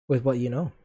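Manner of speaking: ordinary talk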